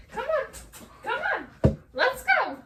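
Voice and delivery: high, sing-song coaxing of a cat; coaxing a pet. Real, not acted. Owner coaxing her cat Simba into view, high and coaxing.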